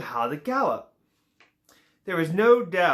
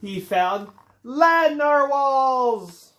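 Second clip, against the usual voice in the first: louder, higher, very slow and amazed; excited discovery; 7 semitones higher, 6 dB louder, much slower than usual. A narrator's reading. Narrator reveals Kelp's discovery of land narwhals, slow, loud amazement. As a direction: stretch it out in wonder, loud and high.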